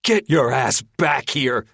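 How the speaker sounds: slow, loud, furious; yelling. Acted. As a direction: roar it after him. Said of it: "Linder yells".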